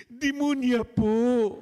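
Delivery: put-on high, loud, slow childish answer; child's voice. Little boy answering the priest, high and slow. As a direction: answer innocently in a squeaky child voice.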